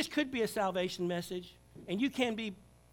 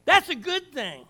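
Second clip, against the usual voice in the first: 1 emphatic repeat; 5 semitones higher, 10 dB louder, slower than usual. Real, not acted.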